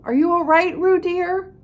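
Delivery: mother Kanga calling anxiously to Roo. Acted. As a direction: call out to her child anxiously. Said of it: "called Kanga anxiously".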